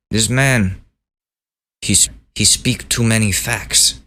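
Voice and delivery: mock Putin with a hint of Russian accent; half-hearted Russian accent; bad Russian accent. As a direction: attempt a Russian accent, not very well. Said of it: "I can't do a Russian accent".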